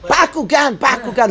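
high, loud delighted echo of the word; surprised echo. Real, not acted. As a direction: pounce on the answer with surprise. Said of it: "who said that?".

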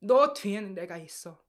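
"너 뒤에는 내가 있어" said fast and loud in Korean; Korean phrase. Shown as a demonstration. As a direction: rattle off "너 뒤에는 내가 있어" quickly and loudly, like mom on the phone. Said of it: "In Korean".

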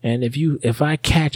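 high-pitched mock warning; mother yelling. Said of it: "She starts yelling at us".

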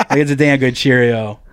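ordinary talk

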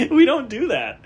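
high laugh, still giggling. Real, not acted. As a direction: giggle out the words. Laughing at breaking chair.

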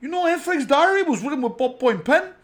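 put-on loud, high New York Italian mobster accent, lightly done; hammed-up Jersey wiseguy accent; a rough Italian-American mobster voice; Paulie Walnuts impression. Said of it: "I do a better Paulie Walnuts".

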